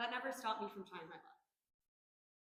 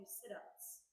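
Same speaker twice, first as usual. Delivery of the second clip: sit-ups whispered, much softer, falling; 11 dB quieter than usual. Acted. Sit-ups, final hushed repeat.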